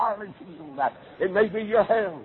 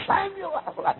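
second "Samuel." called much higher and stretched out; rising call; 9 semitones higher than usual. A narrator's reading. God calling Samuel a second time, higher call. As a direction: lift "Samuel." high as the call repeats. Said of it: "and the Lord said".

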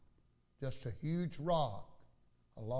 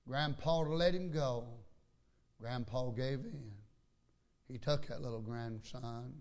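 normal talking voice

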